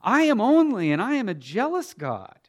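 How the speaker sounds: loud, high opening that settles down; forceful preacher voicing God; jealous God. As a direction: declare an exclusive claim as God. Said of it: "the jealousy that God has".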